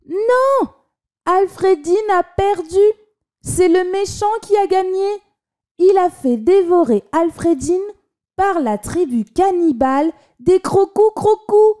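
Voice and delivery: put-on high distressed child's voice; upset child. A narrator's reading. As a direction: wail it like a heartbroken kid. Child distressed over ending.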